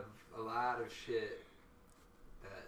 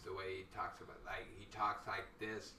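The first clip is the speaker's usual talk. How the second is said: a lower, much softer put-on deep voice; deep Norton voice; 7 semitones lower, 9 dB quieter than usual. Comedian mimics Norton for his podcast cohost, lightly voiced deep impression. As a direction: drop the voice low and soft to mimic the comic. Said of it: "deep, deep voice".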